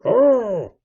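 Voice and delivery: loud, high "Oh!"; quite loud. A narrator's reading. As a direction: exclaim it at full volume. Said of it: "said he, quite loud".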